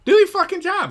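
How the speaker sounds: the same demand pushed up in pitch. Real, not acted. Repeats the demand, higher.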